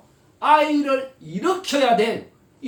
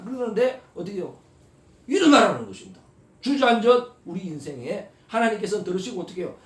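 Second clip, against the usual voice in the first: ordinary talk, nothing marked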